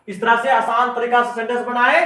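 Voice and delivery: usual speaking voice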